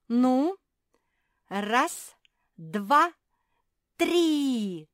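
loud, high, spaced-out countdown; rallying count. A narrator's reading. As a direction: bellow the count and stretch the last. Counting for the second heave.

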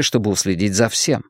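usual speaking voice

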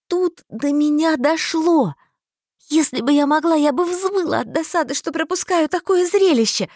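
high, loud rush of vexation; frustrated excitement. A narrator's reading. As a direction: narrate as if ready to howl with frustration. Narrator bursting with frustration.

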